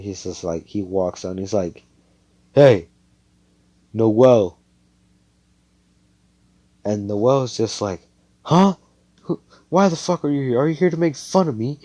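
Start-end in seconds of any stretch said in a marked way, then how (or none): 2.5-4.6 louder call to someone. Acted. Austin walks up to Noelle, calling out. 8.4-11.8 high, fast, startled demand; defensive anger. Acted. Noelle suspects he came to mock her, defensive.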